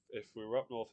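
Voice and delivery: normal talking voice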